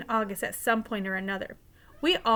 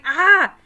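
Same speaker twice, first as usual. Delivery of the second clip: mock scream; 8 semitones higher, 13 dB louder than usual. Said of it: "It wasn't a scream".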